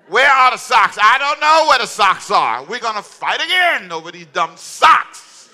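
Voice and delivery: loud, high, exasperated shouting about socks; hammed-up angry shouting, slowing down at the end; mock morning quarrel. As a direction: yell like a frazzled spouse hunting socks. Acted family fight over socks.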